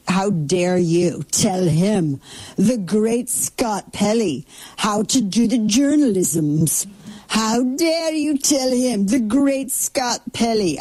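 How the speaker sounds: put-on slow, high, pompous Atlantic-accented outrage; mock indignant Scott Pelley. Mocking the anchor's outrage at being told how to report, pompous and slow. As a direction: ham up the wounded grandeur. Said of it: "quasi light British, like, Atlantic affectation".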